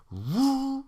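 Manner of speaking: startled lady. Retold or imitated. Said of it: "she was like".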